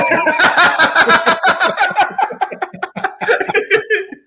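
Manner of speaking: long hearty laughter; loud laugh. Real, not acted. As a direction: laugh big.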